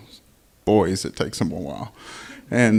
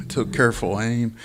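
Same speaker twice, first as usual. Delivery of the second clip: plain reading voice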